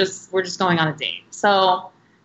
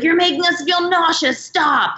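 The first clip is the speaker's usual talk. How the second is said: high, whiny mock protest at the driver; nagging a reckless driver, high and whiny; whiny complaint; 9 semitones higher than usual. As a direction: complain like a carsick kid.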